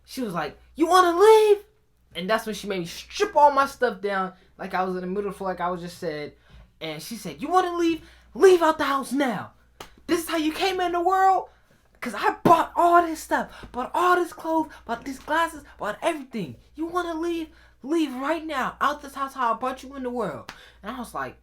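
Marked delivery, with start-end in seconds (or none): 0.8-1.6 high, loud incredulous challenge; outraged mom. Retold or imitated. Mom answering son's backtalk, loud high outrage. 7.4-20.5 high-pitched, indignant rant; angry mom tirade. Retold or imitated. Mom telling son to leave with nothing, high angry rant.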